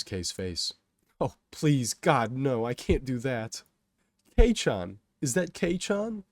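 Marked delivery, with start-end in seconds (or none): 1.2-3.6 louder, higher pleading; dismayed pleading. A narrator's reading. Kay dreading that Kato calls on him, panicky plea. 4.4-6.2 loud call rising high into a question. A narrator's reading. Kato calling into the station to his friend, surprised yell. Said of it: "Kato yells out on".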